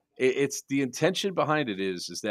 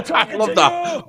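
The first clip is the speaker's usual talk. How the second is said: laughing; 7 dB louder, slower than usual. Real, not acted. Laughing at the clip.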